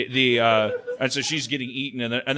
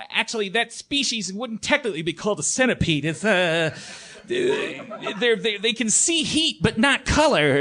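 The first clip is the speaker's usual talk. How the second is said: higher pedantic know-it-all; raised pedantic nerd correction; nerdy kid voice; 8 semitones higher than usual. Retold or imitated. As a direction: correct them like a smug little expert. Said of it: "he's like".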